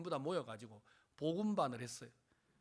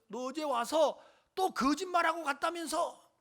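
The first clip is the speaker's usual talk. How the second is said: high, louder angry challenge; cursing accusation; 10 semitones higher, 7 dB louder than usual. Retold or imitated. Angry in-law accuses him of lying, accusing. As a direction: retell an in-law calling him a liar. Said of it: "막 욕을 하면서".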